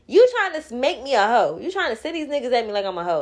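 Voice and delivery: mock confrontation. Confronting the guys who called her names.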